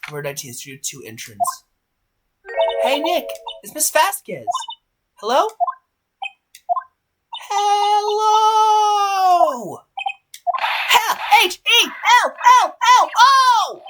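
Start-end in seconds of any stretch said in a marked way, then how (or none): 2.8-5.5 put-on high-pitched girl calling out brightly; eager greeting. Excited girl hailing Miss Vasquez, eager greeting. 7.5-13.8 very high, loud, drawn-out hello; insistent calling out. Acted. Girl hailing someone who ignores her, insistent shout.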